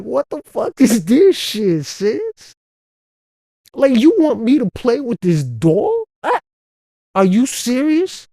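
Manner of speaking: reeling from the surprise, pitch way up; shocked outrage. Retold or imitated. Retelling his shock at his sister's doll, high and incredulous.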